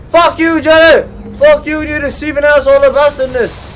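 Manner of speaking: high, loud cursing; furious yell. Real, not acted. Player cursing deceiving Johnny, furious yelling. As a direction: hurl insults at a hated rival.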